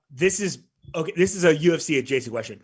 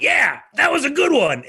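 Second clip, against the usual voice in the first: imitating [name]; 11 semitones higher, 5 dB louder than usual. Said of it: "And he went".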